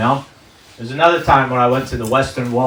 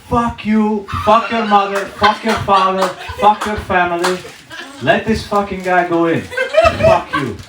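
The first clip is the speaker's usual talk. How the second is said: raised-pitch imitation of a cursing uncle; mock angry yelling; 5 semitones higher than usual. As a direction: rattle off curses like an indignant uncle. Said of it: "you can yell your way".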